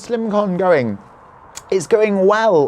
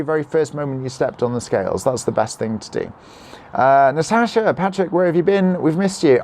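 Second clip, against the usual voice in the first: normal talking voice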